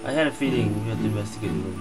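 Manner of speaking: ordinary delivery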